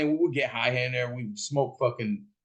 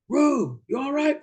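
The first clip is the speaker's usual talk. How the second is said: high, louder worried call; acted high-pitched shout; 14 semitones higher, 4 dB louder than usual. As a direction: holler to check on someone. Calling to screaming brother.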